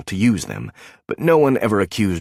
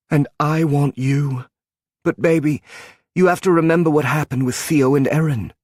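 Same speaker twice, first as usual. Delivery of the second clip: a touch of tearful; 7 semitones higher than usual. Acted. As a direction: hold back the tears as you say it. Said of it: "all tears and heartache".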